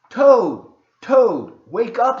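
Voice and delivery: loud, high call to a sleeper; calling to wake. Acted. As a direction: shout him awake from outside. Frog wakes sleeping Toad.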